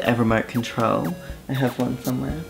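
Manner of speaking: ordinary talk, nothing marked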